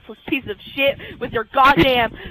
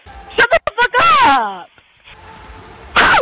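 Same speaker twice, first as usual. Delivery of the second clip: high, loud threatening yell; real screaming gamer rage; furious shout; 7 semitones higher, 6 dB louder than usual. Real, not acted. Raging at the game.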